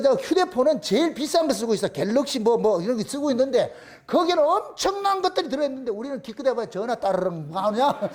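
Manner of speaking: ordinary delivery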